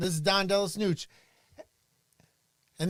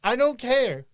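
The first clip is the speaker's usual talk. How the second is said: loud insistence; 6 semitones higher, 9 dB louder, slower than usual. Real, not acted.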